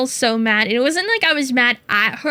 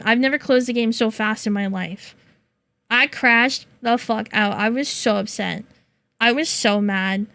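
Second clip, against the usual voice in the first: normal talking voice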